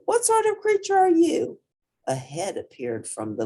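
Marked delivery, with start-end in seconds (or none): 0.0-1.6 put-on high, small child voice for Portly; squeaky polite kid; little hippo voice. A narrator's reading. Portly questions the giraffe.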